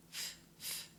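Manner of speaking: whispered f sound; phonics tutor. Shown as a demonstration. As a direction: breathe out the f sound. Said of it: "letter sound demo".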